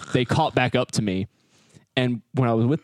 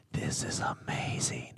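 awed whisper, slow; slow hushed whisper of amazement; 12 dB quieter, slower than usual. Retold or imitated. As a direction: breathe it out in wonder. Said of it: "And I'm like".

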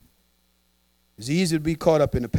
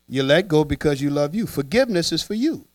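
ordinary talk, nothing marked

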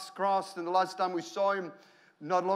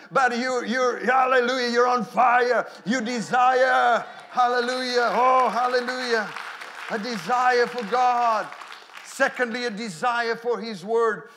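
loud, raised voice at full preaching force; impassioned preaching; 5 semitones higher, 9 dB louder, slower than usual. Real, not acted. Preacher to his congregation about passion for God, full-force preaching. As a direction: preach it out, stretching the big words.

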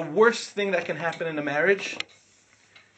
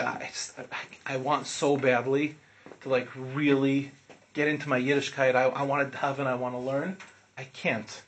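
low, halting, subdued imitation of a distraught student; hesitant and quiet, sinking on the last words; retold devastation; 5 semitones lower, 5 dB quieter than usual. Student confessing to his rabbi what he wants, devastated. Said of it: "he was devastated, crying, bawling".

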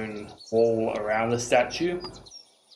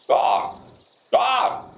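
raven caw; 13 semitones higher than usual. Acted. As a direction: caw sharply like a big raven. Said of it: "And lets out, like".

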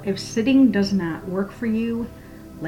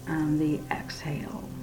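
soft spa whisper; 4 semitones lower, 7 dB quieter than usual. Acted. Exhale cue, whispered.

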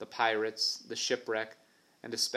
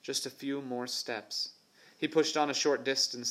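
plain reading voice

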